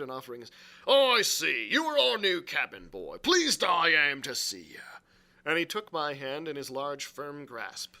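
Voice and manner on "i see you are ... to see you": big, booming welcome; quite loud. A narrator's reading. Silver welcomes the new cabin boy, quite loud. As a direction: greet the newcomer loudly and heartily. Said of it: "said he, quite loud".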